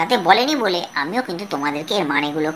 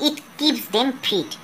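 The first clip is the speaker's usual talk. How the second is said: slow reading aloud; 2 semitones higher, 5 dB quieter, much slower than usual. Shown as a demonstration. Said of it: "teacher reads English passage aloud".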